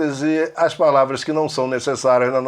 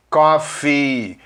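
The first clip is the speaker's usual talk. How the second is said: normal talking voice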